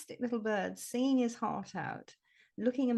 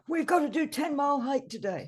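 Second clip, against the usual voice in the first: lightly voiced brisk rambler, pitched higher; bustling hiker; 6 semitones higher, 3 dB louder than usual. Mocking walkers who rush past the scenery, bustling hiker. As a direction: imitate a walker set on covering the miles, higher. Voicing passing hikers on a march.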